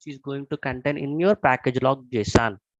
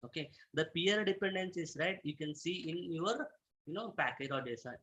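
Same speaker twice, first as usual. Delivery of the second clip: very soft, hard to hear; speaking too quietly; barely audible; 9 dB quieter than usual. Real, not acted. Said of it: "your voice is very low".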